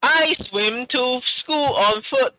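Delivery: high, slow mock broken-English accent; Timur's English. Friends imitating Timur's English, slow mock accent. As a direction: drag out a high-pitched learner's accent. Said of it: "this is Timur's English".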